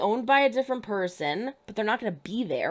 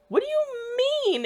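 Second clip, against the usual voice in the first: incredulous; 12 semitones higher than usual. Real, not acted. Exasperated reaction to TV scene.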